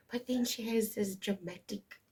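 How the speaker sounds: ordinary talk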